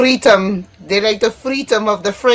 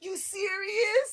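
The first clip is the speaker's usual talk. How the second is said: stunned disbelief; 10 semitones higher, 12 dB quieter, slower than usual. Real, not acted. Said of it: "Yes, I am".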